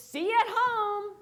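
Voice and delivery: loud, high drive-away shout; imitated parting yell from the car; calling out goodbye. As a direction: holler it back as you speed off. Coach calls out while driving off.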